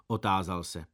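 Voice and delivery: ordinary delivery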